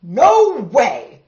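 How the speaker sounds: loud, firm refusal; farmer refusing. Acted. Farmer refusing the cows' demand, loud no. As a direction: shout it flat out. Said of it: "said Farmer Brown".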